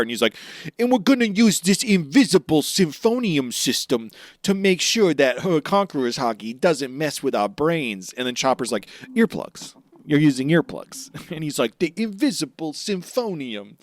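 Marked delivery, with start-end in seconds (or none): 0.8-8.1 loud, raised, hammed-up proclamation; grand scheming announcement. Schemer unveiling his invisible gadget, loud mock-grand declaration. 9.1-11.1 imitated incredulous objection. Chopper deflates the grand claim. 11.8-13.8 mock-grand insistence. Schemer insists on grand name.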